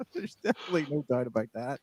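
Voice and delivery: words broken up by giggles; amused, laughing as you talk; laughing agreement. Real, not acted. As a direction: concede through real laughter. Laughing at being beaten on accent.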